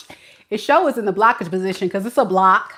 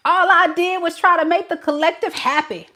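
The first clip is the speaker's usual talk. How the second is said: higher, whining self-pity mock; whiny child; 8 semitones higher than usual. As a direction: play the martyr with a whine. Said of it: "whining and complaining like a child".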